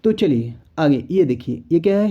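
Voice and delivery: ordinary talk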